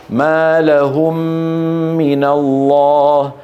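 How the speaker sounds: slow measured recitation. Shown as a demonstration. Teacher reciting the verse as an example. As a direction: recite gently and slowly. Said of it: "لكم ما".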